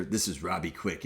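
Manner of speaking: low, understated introduction voice; movie character introduction. Acted. Introducing the new character in a movie, low understated announcement. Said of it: "they'd introduce the new character".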